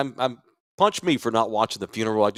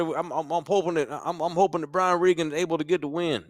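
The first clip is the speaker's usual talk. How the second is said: put-on higher, drawn-out Southern accent that swallows word endings; Southern drawl impression; 8 semitones higher than usual. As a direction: play a good-ol'-boy Southern accent. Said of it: "I can do the accent".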